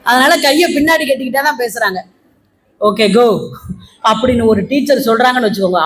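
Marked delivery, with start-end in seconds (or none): 2.9-3.3 low, short mock dismissal; hiding hurt. Teacher dismissing pupil while hurting inside, low and resigned. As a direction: send them off with hidden regret. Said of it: "அவங்க மனசுக்குள்ள அவ்வளவு வருத்தம் இருக்கு".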